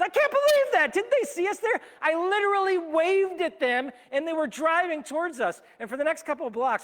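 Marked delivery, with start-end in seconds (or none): 0.0-5.6 loud, much higher indignant rant; fuming. Retold or imitated. Shaken cyclists fume about the driver, loud outraged rant. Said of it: "we're fuming right afterwards".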